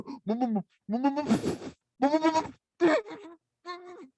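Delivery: high closed-mouth whimpering hums; muffled high mm-mm, fighting giggles; stifled-laugh mumbling. Retold or imitated. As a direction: try to answer while choking back laughter. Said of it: "We're trying to answer".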